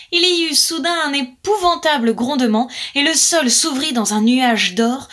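ordinary delivery

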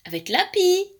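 high, rising astonishment; astonished. A narrator's reading. Pirouette hearing of the magpie, astonished. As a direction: echo it in amazed disbelief. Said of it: "dit Pirouette étonnée".

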